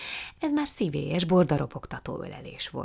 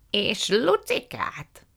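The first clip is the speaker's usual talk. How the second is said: put-on high, slow, drawn-out old lady voice; effusive old aunt; 6 semitones higher, 4 dB louder, much slower than usual. A narrator's reading. Aunt Fan welcoming the guests, slow and high. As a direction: stretch every word with delight.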